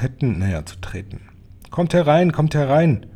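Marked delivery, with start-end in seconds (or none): 1.7-3.1 high, loud, impatient order; snapping command. A narrator's reading. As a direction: order them in sharply. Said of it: "fuhr sie die Hoch".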